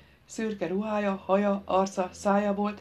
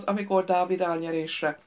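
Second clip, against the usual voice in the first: usual speaking voice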